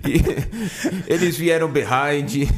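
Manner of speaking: Joel Santana Portuguese-English. Retold or imitated. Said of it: "Joel Santana".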